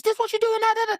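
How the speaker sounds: high, loud mock confrontation; hammed-up angry wife; confronting wife. Retelling the wife confronting her cheating husband, high and loud. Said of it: "And she says".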